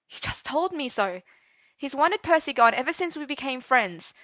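high, rushed panic; frantic worried teen; panicked. Acted. Arthur panicking about his father.

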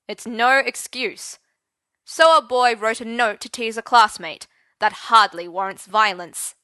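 cold headmaster rebuke; stern. Acted.

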